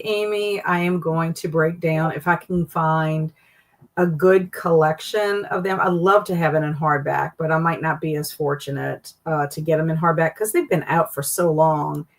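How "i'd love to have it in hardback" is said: normal talking voice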